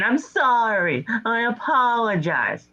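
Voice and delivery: mocking a scripted apology; mock childish apology. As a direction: parrot it like a kid reciting lines.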